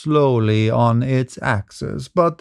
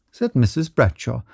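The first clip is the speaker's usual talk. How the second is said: usual speaking voice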